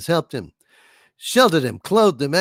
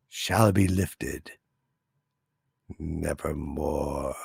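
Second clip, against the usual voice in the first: dramatic poem recital; 10 semitones lower, 4 dB quieter than usual. Acted. Streamer recites The Raven to a chatter, dramatic low recital. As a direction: intone it like a gothic poet, drawing out each line.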